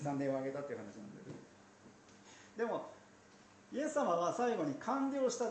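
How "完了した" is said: high ringing cry of completion; cried out. Retold or imitated. Jesus crying out on the cross, a ringing high call. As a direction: lift it up and call it out. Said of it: "叫ばれたんです".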